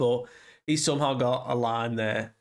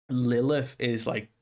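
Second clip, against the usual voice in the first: ordinary talk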